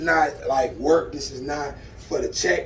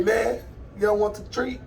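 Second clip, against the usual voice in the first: ordinary talk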